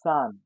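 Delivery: Zahn said "tsahn"; Zahn, sharp s-like start; German pronunciation. Shown as a demonstration. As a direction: hiss the Z of Zahn like s. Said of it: "sounds as S".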